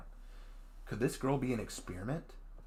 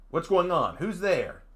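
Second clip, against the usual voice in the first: alarmed; 8 semitones higher, 7 dB louder, slower than usual. Acted. Waking alarmed in a cave.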